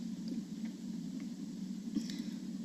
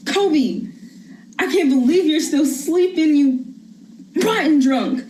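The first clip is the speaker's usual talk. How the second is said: furious mother; 5 semitones lower, 11 dB louder than usual. Acted. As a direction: let the fury boil over. Mother rages at partner, son.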